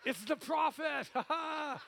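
high, loud laughing cry; laughing shout of fake horror at the prophet; laughing mock alarm.

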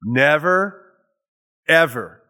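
"Never, ever" with stress on both "Never" and "ever"; "Never" and "ever" both stressed, with a pause between; emphatic never ever. Shown as a demonstration. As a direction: punch "Never" and then "ever", each as its own beat. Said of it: "it's emphatic".

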